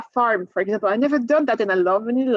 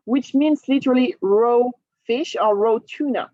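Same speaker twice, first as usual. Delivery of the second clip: usual speaking voice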